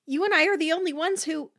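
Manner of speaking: high, fast, loud, left unfinished; alarmed, unfinished outburst; trailing off. A narrator's reading. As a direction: rush in, then leave the thought hanging. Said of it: "her voice trailed off".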